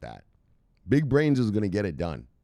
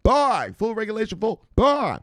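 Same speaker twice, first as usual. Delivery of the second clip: high, loud, hammed-up urging to buy; mock Gensler hype; 12 semitones higher, 5 dB louder than usual. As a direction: play the regulator cheering buyers on, pitched way up. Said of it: "He might wanna be like".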